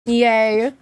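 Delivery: ordinary talk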